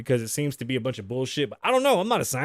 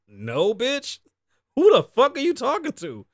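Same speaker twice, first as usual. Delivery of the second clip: mock outraged retort; 9 semitones higher, 4 dB louder than usual. Retorting to the request.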